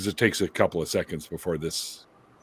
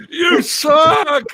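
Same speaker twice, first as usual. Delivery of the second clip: high, loud jeering yell; heckler shout; 6 dB louder than usual. Pretend. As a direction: boo the comic off the stage. Heckler shouting at bad comic.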